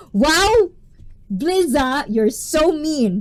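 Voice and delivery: loud, high, playful indignation at a teasing viewer; mock outrage; mock-offended outburst.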